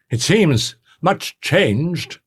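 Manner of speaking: slow, stunned murmur; reply pale with shock; shaken. A narrator's reading. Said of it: "very pale".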